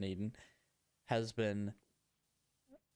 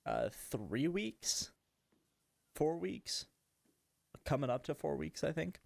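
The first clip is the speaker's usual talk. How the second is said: ordinary talk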